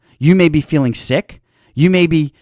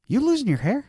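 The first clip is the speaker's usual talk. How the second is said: teasing; 11 semitones higher, 7 dB quieter, faster than usual. Retold or imitated. Teasing remark at the gym.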